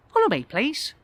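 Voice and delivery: high-pitched character voice; receptionist voice. Acted. Receptionist leading guest down corridor, high voice. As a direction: usher him along in a high voice.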